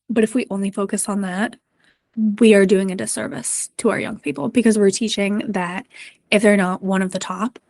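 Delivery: usual speaking voice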